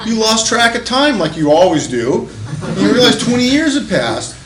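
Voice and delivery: high, loud scolding tirade; exasperated wife. Retold or imitated. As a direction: berate him like a fed-up wife, hammed up. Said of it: "She goes".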